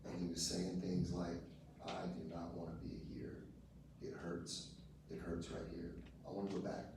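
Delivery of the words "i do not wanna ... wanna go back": put-on soft, lower voice for the boy; hushed, understated plea; retold hurting boy. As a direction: quote the boy's pain quietly. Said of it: "talking like he was a grown-up".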